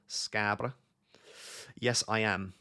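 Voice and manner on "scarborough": bad Scarborough accent, the name shortened to "Scabra"; terrible Scarborough accent. Shown as a demonstration. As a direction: have a rough go at a Scarborough accent. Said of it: "it's a terrible Scarborough accent".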